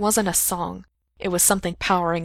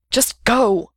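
louder, higher sharp command; impatient raised-voice snap; snapped; 5 semitones higher, 6 dB louder than usual. A narrator's reading. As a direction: cut them off with an urgent order. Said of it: "he snapped".

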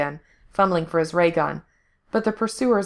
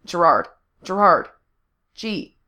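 calling his name; 4 dB louder than usual. A narrator's reading. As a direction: call out to get his attention. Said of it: "Frank was calling his name".

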